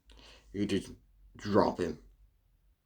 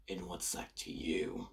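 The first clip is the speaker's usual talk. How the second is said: hushed cold threat, barely voiced; menacing whisper; 3 semitones lower, 12 dB quieter than usual. Suku whispering a cold threat at Sting. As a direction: whisper it like a quiet warning.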